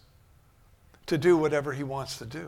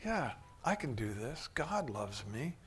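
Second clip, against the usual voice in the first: quiet inner thought; 3 semitones lower, 8 dB quieter than usual. Acted. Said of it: "start thinking, like".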